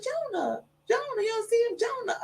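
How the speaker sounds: put-on high toddler voice, a bit soft; little girl voice. Little girl tells grandma she sees Jonah, high child voice. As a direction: say it high like a small giggly girl. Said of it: "She said".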